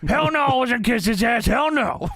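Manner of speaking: high-pitched mock protest; hammed-up high outburst. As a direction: imitate an indignant refusal up high. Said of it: "And J R says".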